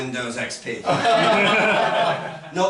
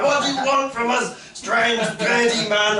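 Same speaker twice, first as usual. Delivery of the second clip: higher-pitched mock distress; confused and upset; 7 semitones higher than usual. Confused people in Kent to a strange caller, mock upset. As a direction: plead as a bewildered householder. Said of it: "they get very confused and upset".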